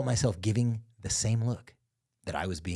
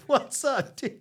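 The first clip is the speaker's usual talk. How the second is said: pumped-up bro greeting; 13 semitones higher, much slower than usual. Retold or imitated. Mimicking a tipsy dad greeting him at parties, high hyped bro voice. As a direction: play the overeager buddy dad. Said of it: "retold dad greeting".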